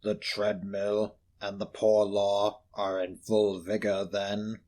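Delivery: low, slow, hushed reply; cold old miser. Acted. Scrooge telling the gentleman of Marley, low and cold. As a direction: answer flatly and coldly.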